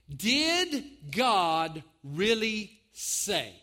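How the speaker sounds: very slow, drawn-out question; serpent's question. Acted. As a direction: stretch each word out slowly. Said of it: "he said to the woman".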